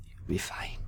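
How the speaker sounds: whispered comfort, barely voiced; soft whisper. Paladin reassuring the girl, whispered. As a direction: breathe it to the child.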